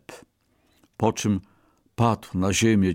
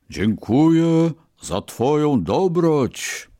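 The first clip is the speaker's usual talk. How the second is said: higher, louder and slow, ringing with power; strong resonant voice; 7 semitones higher, 5 dB louder, slower than usual. A narrator's reading. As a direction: let the god's own voice ring out. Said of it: "odzyskawszy dźwięczny, mocny głos".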